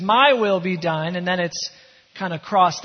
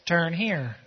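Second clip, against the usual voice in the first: soft, lower robotic direction; GPS voice; 4 semitones lower, 7 dB quieter than usual. Acted. Pastor voicing God's GPS, low and soft. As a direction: murmur it like a navigation voice. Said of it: "this little voice says".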